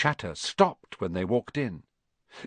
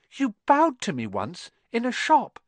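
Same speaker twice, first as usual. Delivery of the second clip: put-on young Harry; 11 semitones higher than usual.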